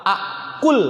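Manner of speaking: "akul" closed on l; sukun ending. Shown as a demonstration. Teacher showing effect of lam, "akul" stopped on l. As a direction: end "akul" with no vowel after the l. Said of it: "maka sukun akhirnya".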